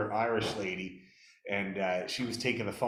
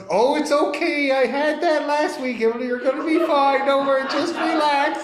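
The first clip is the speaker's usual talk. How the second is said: much higher, louder mock reassurance; energetic radio host; 10 dB louder than usual. Radio host reassures listener before colonoscopy, loud high-pitched imitation. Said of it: "the radio host goes".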